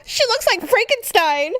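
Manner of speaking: high giggling taunt; laughing mock insult; mean giggle. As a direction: sisters snickering at a bad haircut. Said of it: "we both are like".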